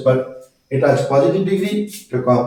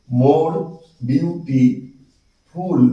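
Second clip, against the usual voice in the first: very slow "more beautiful", with gaps; broken into syllables; much slower than usual. Shown as a demonstration. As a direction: draw out "beautiful" syllable by syllable after a pause. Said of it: "ভেঙে ভেঙে উচ্চারণ করতে হয়".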